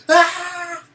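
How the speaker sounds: loud, high mock-fright cry; scared scream. Shown as a demonstration. Teacher leading pupils in showing scared, mock scream. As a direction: scream as if startled, playacting. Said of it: "Everybody show me scared".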